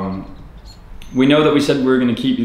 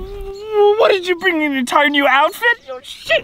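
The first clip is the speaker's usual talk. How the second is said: pitched way up in dismay; high horrified whine. Acted. Newcomer shown a new outfit, high dismayed whine. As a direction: recoil at the outfit.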